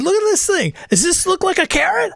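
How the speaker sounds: very high, outraged demand; indignant outcry. Acted. Dirk holding up the finger, high and outraged at the barkeep. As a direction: shove the finger in his face, shrill with outrage.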